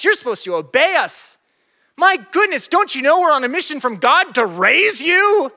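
high, loud frantic parent scolding; out of control. Acted. Parents find their lost son in the temple, out of control. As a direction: act a hammed-up parent at the end of their rope. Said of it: "They were out of control".

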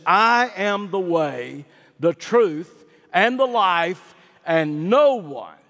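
solemn scripture quote. Acted. Preacher quoting Jesus to the church, slow solemn proclaiming. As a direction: proclaim each phrase like a verdict. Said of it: "what Jesus said in John fourteen six".

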